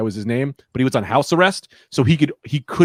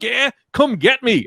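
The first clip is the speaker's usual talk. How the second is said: high, slow goading call; mock taunt; 9 semitones higher, slower than usual. Kid lures bullies to the lawn, mock taunting. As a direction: egg them on in a drawn-out singsong.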